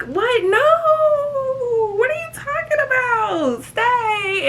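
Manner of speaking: high, whiny, near-crying plea; mock pleading. To Megan calling an Uber, high whiny begging. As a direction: beg her to stay.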